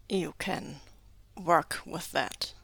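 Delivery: slow, lowish and measured; slow, doubtful echo. A narrator's reading. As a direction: echo it slowly, as if unsure of trusting. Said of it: "says slowly in the tone of a man who maybe should not be so trusted".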